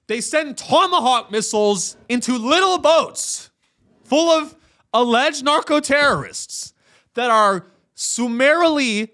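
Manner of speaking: high-pitched, incredulous rant; worked-up, mocking indignation, voice riding high; incredulous outrage. Real, not acted. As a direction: pitch it way up in exasperated disbelief. Mocking outrage at missile strikes on boats.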